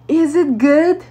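high, loud, over-bright question rising at the end; retold as a high, loud check; eager awkward asking. Speaker to the rapper drinking her juice, awkwardly eager.

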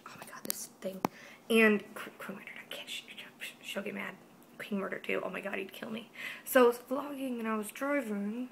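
hushed secret whisper. Real, not acted. Confessing vlogging while driving, whispering. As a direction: keep it hushed so she won't hear.

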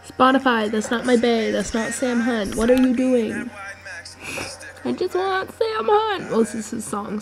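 usual speaking voice

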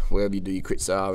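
ordinary talk

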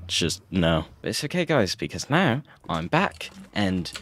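normal talking voice

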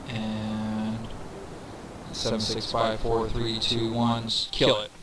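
usual speaking voice

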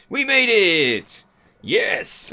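loud slow cheer of relief; triumphant. Real, not acted. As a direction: shout it out in victory. Reaching shore after dangerous swim.

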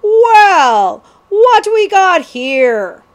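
booming, drawn-out ruffian's greeting; very loud and high, a rough outlaw hailing his catch; great rough booming. A narrator's reading. Cutthroat finds two boys in the fog, rough booming voice. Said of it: "There came a great, rough, booming reply".

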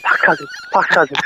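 imitated breathless, voiceless gasping of the words; hyperventilating excitement. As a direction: gasp it out as if too excited to breathe. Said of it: "like, hyperventilating".

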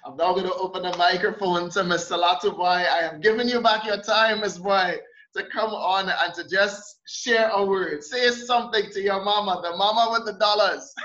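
usual speaking voice

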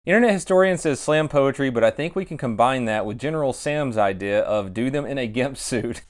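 ordinary talk

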